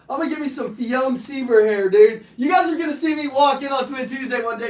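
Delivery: loud, high hyped-up bragging; excited boast. Real, not acted. Speaker hyping his future hair to the crowd, loud and high. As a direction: shout it like a dream come true.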